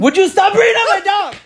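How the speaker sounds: loud, high exasperated yell at a friend; exasperated shout. Real, not acted. To his co-host about mentioning his dog, an exasperated shout.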